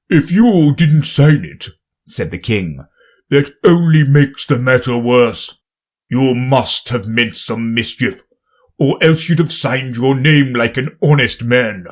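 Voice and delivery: booming king; pompous king, loud and grand. Acted. King accuses.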